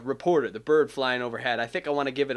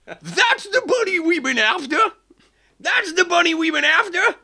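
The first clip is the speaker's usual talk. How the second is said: much higher, louder rooster father; 5 dB louder than usual. Acted. Father Rooster retake spotting the bunny. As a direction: crow the line out. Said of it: "let me give that line".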